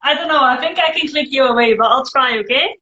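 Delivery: ordinary delivery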